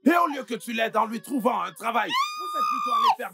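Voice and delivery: high-pitched shouted reproach; shrill, furious scolding; angry accusation. Acted. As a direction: yell the blame, voice climbing very high. Accusing in a heated quarrel.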